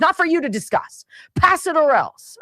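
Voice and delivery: mock arrogant politician. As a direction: sneer it like a bullying lawmaker.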